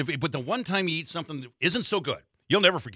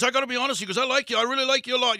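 lightly imitated South African accent, higher in pitch; 7 semitones higher, 4 dB louder than usual. Drinker leaning on his host, imitated South African accent. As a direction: imitate a tipsy South African leveling with a pal. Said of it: "thick, like, you know, South African accent going".

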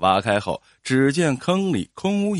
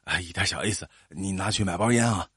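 low, soft and ingratiating; furtive offer; 7 semitones lower, 6 dB quieter, faster than usual. A narrator's reading. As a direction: slip it in under the voice. Slipping a bribe to the official. (压低声音讨好地说)

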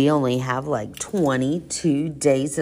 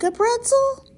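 squeaky high. Acted. Squealed silly question.

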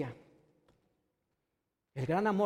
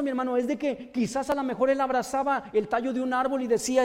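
louder, higher impassioned preaching; preaching at full force; 4 semitones higher, 6 dB louder than usual. Acted. Preacher tells the congregation of Bartimeo, fervent loud preaching. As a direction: build the sermon to a fervent climax.

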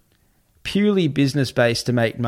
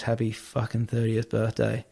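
soft, deadpan self-congratulation, retold; resigned muttering; 8 dB quieter than usual. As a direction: imitate a defeated man's quiet bitterness. Retold bitter, resigned birthday remark.

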